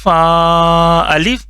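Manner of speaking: drawn-out syllable reading, slow and clear; slow teacher demo. Shown as a demonstration. Teacher reciting the letter for students, slow and drawn out. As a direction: model the letter name slowly for the class. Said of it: "یہ ہو گیا".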